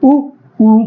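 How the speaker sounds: उ short then उ long, lips rounded; lip vowels. Shown as a demonstration. Teacher showing students rounded-lip vowels. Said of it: "कहाँ से आ रहे हैं".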